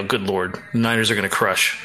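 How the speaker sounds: usual speaking voice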